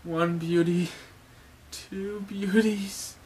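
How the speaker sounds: high, slow, feeble lovestruck moan building to a whimper; Sanji swooning. Acted. Sanji dying of a nosebleed over beauties, faint swooning. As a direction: count the beauties, then break into an overwhelmed cry.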